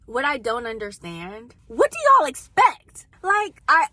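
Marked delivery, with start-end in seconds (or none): 1.7-3.9 high, loud, slow disbelief; exasperated. Real, not acted. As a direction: throw the question up in disbelief. Exasperated at dating show women.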